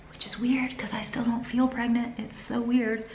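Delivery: soft whispered aside. Real, not acted. Telling viewers a secret, whispered. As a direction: whisper it to the camera. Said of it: "whispered aside".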